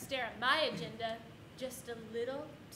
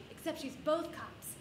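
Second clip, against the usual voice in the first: ordinary delivery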